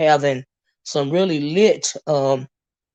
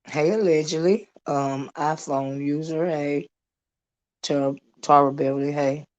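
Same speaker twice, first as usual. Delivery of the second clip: normal talking voice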